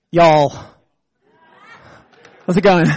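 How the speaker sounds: a token try at an American accent; mock American accent. Shown as a demonstration. Immigrant preacher showing he is learning to talk American, mock American accent. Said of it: "learning to talk American".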